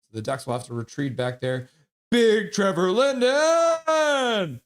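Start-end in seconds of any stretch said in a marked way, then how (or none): 2.1-4.6 high, loud, very drawn-out name; announcer-style hype. Real, not acted. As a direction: holler it, holding the final syllable. Hyping a name mid-game.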